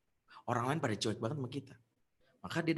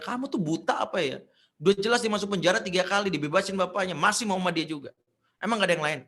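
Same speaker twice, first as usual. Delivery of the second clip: louder, higher, exasperated telling-off; nagging scold; 7 semitones higher, 6 dB louder, faster than usual. Retold or imitated. Single mother scolding her child over a fiancé, exasperated. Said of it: "Gue cuman bisa ngomel".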